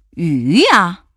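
loud, drawn-out proud answer. A narrator's reading. Pirate captain answering the monster, proud. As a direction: declare it with pride. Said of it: "海盗头目自豪地说".

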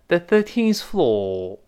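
British "floor" without the r; British accent. Shown as a demonstration. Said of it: "英式发音". (英式发音)

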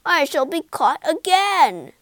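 high, loud lament; wailing. A narrator's reading. Toad fearing he'll be caught, wailing in despair. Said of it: "he wailed".

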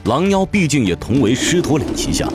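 ordinary delivery

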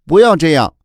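loud, high cry of protest. Acted. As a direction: shout it out to stop them. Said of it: "小龙人忍不住叫了起来". (大声急切地喊出来)

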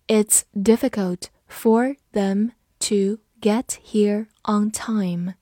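slow, word by word with gaps; slow read-through. Shown as a demonstration. Teacher reading the example sentence for learners, slowly. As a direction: read it slowly, each word set apart. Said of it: "好，慢读一遍".

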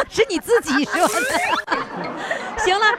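amused, laughing retort. Real, not acted. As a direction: say it while cracking up. Amused by the guest's own slip.

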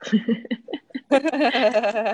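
long rippling laugh. Real, not acted. Teacher amused by student's reply, laughing. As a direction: burst into a sustained chuckle.

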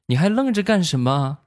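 put-on high child's voice scolding his dad; a small boy snapping orders at grown-ups; bossy little kid. Nephew scolding his stunned dad, high bossy child voice. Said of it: "小家伙说话了".

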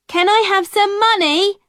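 put-on girl pleading again, voice up high; begging girl calling. A narrator's reading. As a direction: call after him, begging harder. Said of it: "Alison called again".

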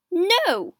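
loud, high-pitched defiant no; firm refusal. A narrator's reading. Queen refuses armed lord.

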